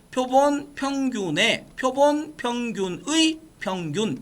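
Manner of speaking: slow, deliberate reading, word by word; measured teacher's read-along; read-aloud model. Shown as a demonstration. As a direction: spell out the phrase slowly for students to repeat. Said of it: "이렇게 읽으시라고".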